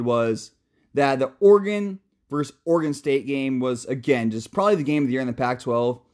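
ordinary talk

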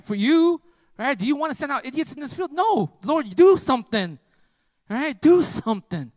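loud, high-pitched plea to God; pleading prayer. Retold or imitated. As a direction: implore urgently, punching the key words. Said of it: "I was just praying in my heart".

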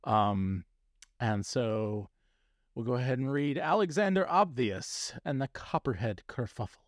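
ordinary talk

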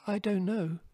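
put-on high, small voice of an anxious young rabbit; miserable. A narrator's reading. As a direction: pitch it far up, helpless. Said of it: "he concluded miserably".

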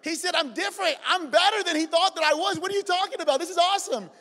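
bursting with clueless joy; excitedly misreading the father's words; excited, mock naive. Retelling his own naive excitement.

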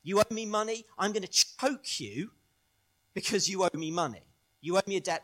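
acted vengeful outburst; vengeful creditor. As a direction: threaten the debtor with mounting force.

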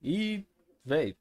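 ordinary talk

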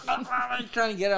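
a hint of a defensive friend; imitating friend. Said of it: "She's like".

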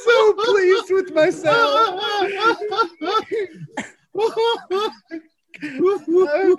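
cracking up, barely getting words out; laughing to tears. Real, not acted. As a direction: struggle to talk through tearful laughter. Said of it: "I'm fucking crying".

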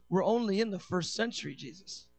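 low, soft Father's reply; soft low voice of God the Father; calm fatherly answer. Acted. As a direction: gently hold him back, quiet and low. The Father restrains Jesus.

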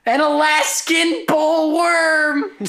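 very high, stretched-out holler; mock yell. Co-host topping the shouting bit, high slow mock scream. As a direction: bellow it slowly in a high comic voice. Mock yelling, joke quote.

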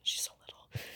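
whispered mock sympathy for a little one; very soft, breath-only retold remark; hushed aside. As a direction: drop to a tiny imitated whisper. Voicing coworkers pitying the youngest.

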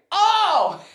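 high, loud burst of laughter; laughing. Real, not acted. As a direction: crack up at the memory. Laughs at Americans' reaction.

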